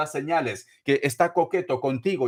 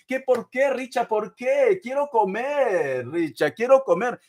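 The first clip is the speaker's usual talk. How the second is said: pleading client; 6 semitones higher than usual. Pretend. Voicing client pleading to Richard.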